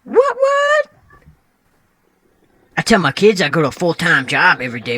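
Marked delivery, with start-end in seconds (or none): 0.0-0.9 sudden high squeak on both words; squeaky catchphrase. Acted. Odd guest squeaking his catchphrase at the host, high. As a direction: yelp it up high like a cartoon.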